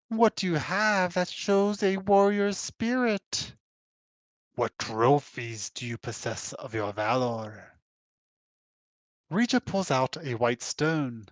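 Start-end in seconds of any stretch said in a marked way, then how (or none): 0.1-7.8 slow, grave creature voice; slow, solemn creature voice; Quattro voice. Acted. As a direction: weigh each word slowly.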